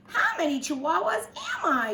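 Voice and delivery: high-pitched amazed question; wondering out loud. A narrator's reading. Kitty boy at the mirror, wondering out loud. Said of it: "he wondered out loud".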